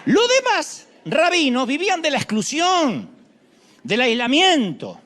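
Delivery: loud, high declamation against the rabbis; forceful preaching. Real, not acted. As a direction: hammer the point home. (predicación fuerte y aguda contra los rabinos)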